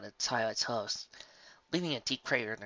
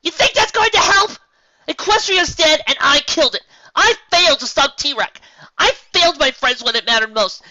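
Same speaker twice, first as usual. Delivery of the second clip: much higher, loud despairing scream; 15 dB louder than usual. A narrator's reading. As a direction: Twilight rages at Cadance. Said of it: "Twilight was screaming".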